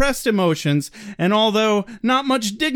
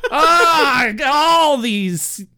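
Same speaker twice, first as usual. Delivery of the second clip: mock dad epiphany; 7 semitones higher, 3 dB louder, slower than usual. Dad's mock revelation.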